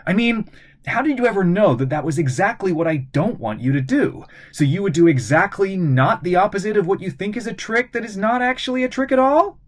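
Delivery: put-on quick, high cheeky kid; fast-talking boy. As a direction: rattle it off breathlessly. Lucas rattles off logic.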